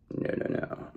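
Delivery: breathy whisper, no voice at all. Real, not acted. Whispering a taunt to the viewer's enemies. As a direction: hush it, a secret taunt. Echoes the refusal, hushed.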